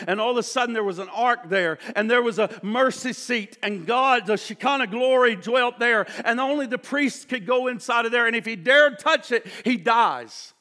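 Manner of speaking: loud, driving pulpit delivery; forceful sermon build; full-force preaching. Real, not acted. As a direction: preach it hard and fast.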